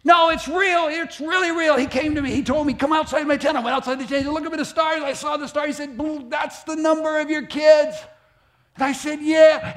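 high, loud, breathless excitement; excited Abram. Acted. Abram telling Sarai about the stars, high and thrilled. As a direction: gush it out like a giddy believer.

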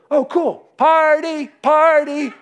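high, loud, slow mock whoop; carefree party. A believer answering Jesus, high giddy whoop. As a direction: cheer like a kid at a party.

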